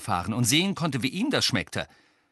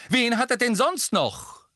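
high, loud, heated question; vehement; 5 dB louder than usual. A narrator's reading. Mrs Weasley challenging Sirius over Harry, vehemently. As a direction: demand it heatedly, voice pitched up. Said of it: "sagte Misses Weasley heftig".

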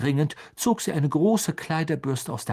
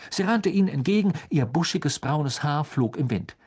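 usual speaking voice

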